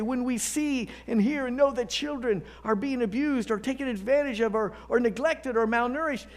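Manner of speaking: high, loud, impassioned sermon delivery; full-force preaching. Acted. Pastor urging the congregation to righteous anger, preaching loud and high. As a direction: preach it hard, rising to the rafters.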